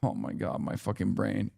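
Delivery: low, under-the-breath self-scolding; softly cursing own brain; muttered frustration. Real, not acted. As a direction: grumble at yourself quietly. Frustrated at own word mix-up.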